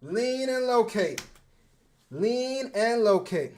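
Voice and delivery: "Lean and locate" spaced out, slow and high; slow drilled slogan. Shown as a demonstration. Coach-style player repeating his catchphrase to viewers, slow and deliberate. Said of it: "I talk about this all the time".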